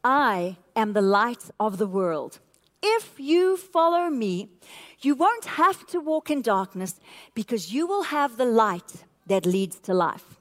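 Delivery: scripture reading. Shown as a demonstration. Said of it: "And it says this".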